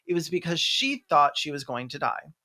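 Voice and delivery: ordinary talk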